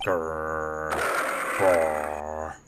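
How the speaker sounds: low rolling growls; imitated big-cat growling; tiger growl. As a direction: growl twice like a tiger, deep and long. Voices the game's tiger growling.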